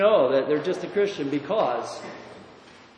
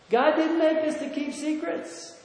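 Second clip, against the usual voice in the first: much higher, animated preacher's pitch; lively preaching; 8 semitones higher than usual. Acted. Preacher to congregation on secrets, high and animated. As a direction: lift it up and drive the point.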